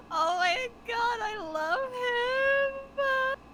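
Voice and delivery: high, loud, drawn-out fangirl wail; mock crying. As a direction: wail it out as if overcome with love. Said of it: "I started crying".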